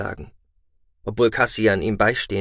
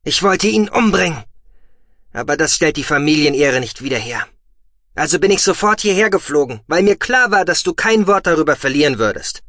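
loud, high, furious outburst; 7 semitones higher, 6 dB louder than usual. Acted. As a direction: rage at the insult, fast. Brother enraged over family honour.

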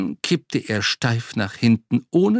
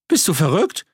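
aghast; 8 semitones higher, 4 dB louder than usual. Acted. Butcher aghast at offer.

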